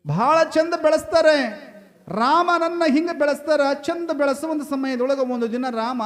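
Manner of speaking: high, loud, declaimed discourse; full-force preaching. Acted. Preacher extolling mothers to the congregation, full-force preaching.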